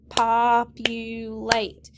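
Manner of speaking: slow, syllable by syllable, clapped out. Shown as a demonstration. Teacher claps the word out for students, slow syllables. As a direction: clap each syllable slowly. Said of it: "Clap it".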